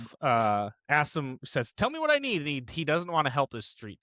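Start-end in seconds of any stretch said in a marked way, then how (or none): 1.7-2.4 very high mock demand. Retelling the street tough, mocking high demand.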